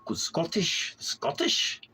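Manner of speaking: high, exaggerated Scottish accent; "Scottish" with a sharp Scottish lilt; hammed-up Scottish pronunciation; mock Scottish accent. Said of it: "talk about accents".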